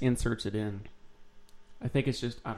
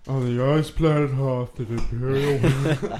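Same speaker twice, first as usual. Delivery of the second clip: slowed-down playback voice; slower than usual. Retold or imitated. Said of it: "It wasn't quite that noticeable".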